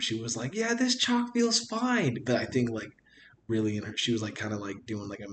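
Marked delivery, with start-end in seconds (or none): 0.5-2.2 high, drawn-out strained cheer; slow, very high mock-convinced voice; fake it till you make it. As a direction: imitate someone forcing themselves to sound fine. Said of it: "fake it till you make it".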